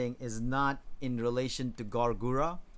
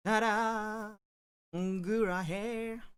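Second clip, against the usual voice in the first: cute anime streamer; 10 semitones higher, much slower than usual. Pretend. Showing off hair, cute.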